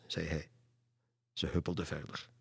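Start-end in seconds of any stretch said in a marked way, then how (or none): none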